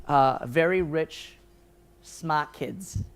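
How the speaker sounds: slow, hammed-up Boston accent, lightly done; a mock Boston drawl on rich smart kids; joking bad Boston accent; terrible Boston accent. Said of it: "my terrible attempt at a Boston accent".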